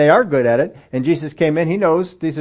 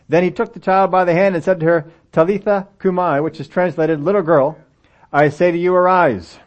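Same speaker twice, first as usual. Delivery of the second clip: scripture reading; 3 semitones higher than usual. Acted. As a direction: proclaim the passage to the whole church.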